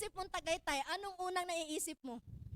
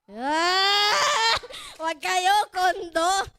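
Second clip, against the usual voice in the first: excited shout; 15 dB louder, much slower than usual. Real, not acted. Excited about condo.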